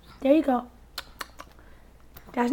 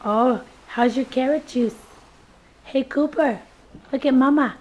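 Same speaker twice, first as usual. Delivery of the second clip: normal talking voice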